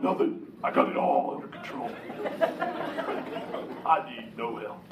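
chuckling through a put-on manly voice; mock manly deep voice. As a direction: play the tough guy who needs no help, laughing. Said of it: "they use that deep voice 'cause they're trying to feel manly".

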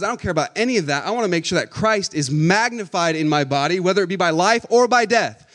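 loud, driving sermon voice; full-force preaching. Acted.

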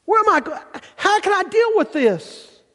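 very high, strained, desperate cry; exasperated father crying out; disappointed parent crying out. Acted. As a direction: wail it like a parent at the end of their rope. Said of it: "the disappointed parent who cries out".

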